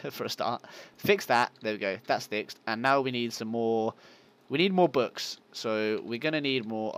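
usual speaking voice

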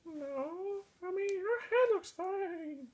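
high, soft little voice; ghost character. Acted. Puppet ghost character replies.

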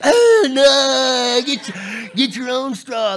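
loud high scornful refusal; teen refusing. Retold or imitated. Preacher voicing a teenager refusing to share, loud and scornful. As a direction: recoil at the parent like they're gross. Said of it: "And then it's your time".

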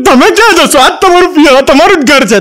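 high-pitched mock protest; acted protesting wife. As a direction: imitate a wife stopping her husband at the door. Wife stops husband leaving home.

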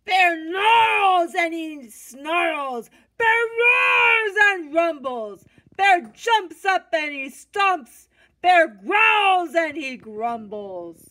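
put-on reading the waking bear loud to the kids; booming narration. A narrator's reading. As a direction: roar the lines out like the angry bear. Bear wakes angry, read loudly.

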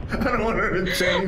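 laughing through the words; giggling plea. Real, not acted.

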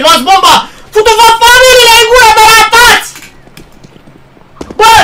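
very loud, high raging yell; furious scream. Real, not acted. Gamer screaming at teammates to grab the bomb. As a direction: scream it with all your anger.

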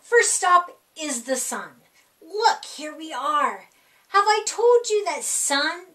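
plain reading voice

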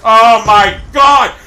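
real rage, high and loud; slow drawn-out angry yell; furious shout. Real, not acted. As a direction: scream your hatred at the game. Rage after another death.

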